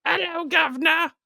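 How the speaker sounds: high, hammed-up British accent. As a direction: squeak it out like a cartoon cockney. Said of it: "his British accent".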